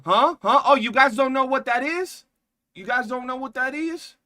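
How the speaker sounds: high, loud incredulous questions; surprised disbelief. Real, not acted. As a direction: act shocked that chat doesn't know. Reacting to chat in disbelief.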